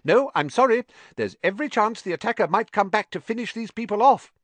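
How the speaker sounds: high, clipped school nurse voice; stern matron. A narrator's reading. Nurse turning students away, high and severe. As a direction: severe nurse through a door. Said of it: "told them severely".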